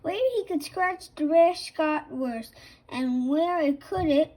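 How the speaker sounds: ordinary delivery, nothing marked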